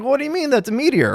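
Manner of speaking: high, quick, indignant protest; indignant finder. Retold or imitated. Finder protesting to the expert, high and quick. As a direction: pitch it way up in disbelief. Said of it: "And they're like".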